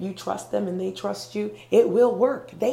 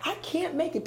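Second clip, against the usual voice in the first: scared; 7 semitones higher than usual. Acted. Climber stuck halfway up, acted scared. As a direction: voice a climber giving up in fear, pitched up. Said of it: "because I'm scared".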